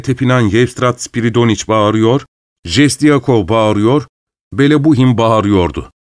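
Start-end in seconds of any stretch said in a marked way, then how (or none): none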